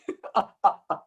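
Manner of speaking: short amused chuckle; laugh. Real, not acted. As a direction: chuckle at your own story. Amused by the gopis' story.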